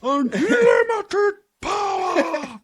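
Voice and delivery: very high, drawn-out villain shriek; hammed-up evil shout, each word stretched; Emperor Palpatine. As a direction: screech it slowly like a power-mad emperor. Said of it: "say it like fucking Emperor Palpatine".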